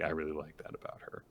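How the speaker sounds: soft, near-silent admission; quiet. Retold or imitated. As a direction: say it under your breath.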